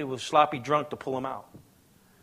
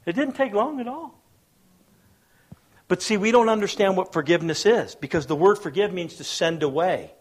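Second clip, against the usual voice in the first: ordinary talk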